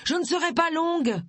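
high call through a closed door; high, hurried-sounding reassurance; shouted. A narrator's reading. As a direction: call out insistently, pitched high. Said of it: "cria-t-elle".

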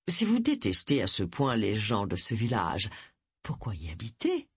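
silky, falsely polite question; honeyed tone. A narrator's reading. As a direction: ask it sweetly with a barb underneath. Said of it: "d'un ton mielleux".

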